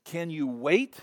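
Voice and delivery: usual speaking voice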